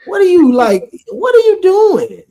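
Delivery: exasperated, high incredulous outburst. Real, not acted. Baffled challenge to co-host.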